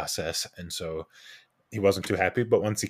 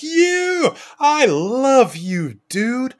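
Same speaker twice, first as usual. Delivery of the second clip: much higher and louder, stretched slowly; overjoyed shout; 9 dB louder, much slower than usual. Retold or imitated. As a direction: belt it like a giddy patient seeing his favorite. Patient greets him, overjoyed.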